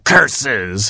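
ordinary delivery, nothing marked

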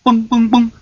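high drummed boom boom; mock drum sound. The teeny frog showing off his drumming, sung boom. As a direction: beat it out like a drum.

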